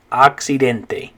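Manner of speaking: Accidente slowly, ahk-see-DEN-teh; slow Spanish model word. Shown as a demonstration. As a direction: say Accidente clearly for students to copy. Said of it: "in Spanish it's the word".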